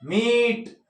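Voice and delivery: ordinary talk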